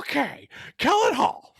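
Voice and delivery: high, loud announcer caricature; hammed-up high-pitched character voice; Harry Carey. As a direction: push the voice way up into a broadcaster impression. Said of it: "read it as Harry Carey".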